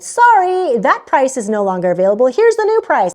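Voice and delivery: sing-song fake apology from the booking site; website message voice. Booking site telling the traveler the price rose, in a high mock-cheerful voice.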